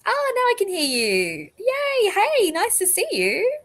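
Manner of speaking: high, bright squeal of welcome; excited greeting. Real, not acted. Host welcoming a guest who finally connects, excitedly high. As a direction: greet the newcomer with a delighted high voice.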